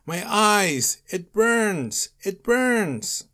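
high, loud cries of agony; terrified shriek. A narrator's reading. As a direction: shriek as if burning. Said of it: "Nosferatu shrieked in terror, yelling".

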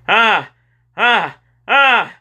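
short, high, loud screams; repeated screams. A narrator's reading. Boy running to get away from that snake, screaming. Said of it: "he kept screaming over and over".

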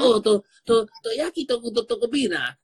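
high playful party voice; party guest. Retold or imitated. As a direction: sound like a guest arriving late and eager to dance. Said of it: "Congolese is like magic time".

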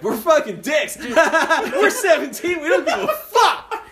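high, loud laughing boast; laughing brag. Retold or imitated. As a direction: cackle through it, carefree. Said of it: "I'm like".

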